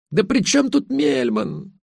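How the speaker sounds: very high, drawn-out protest; exasperated wail. A narrator's reading. Wife exasperated in acted quarrel. (отчаянно-раздражённо)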